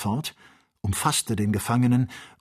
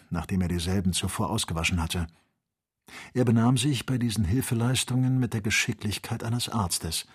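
ordinary delivery

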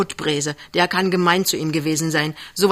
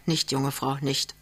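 soft, hushed reassurance; calm her down softly; quiet, restrained comforting; gently soothing; 3 semitones lower, 7 dB quieter than usual. A narrator's reading. He holds and calms the crying woman.

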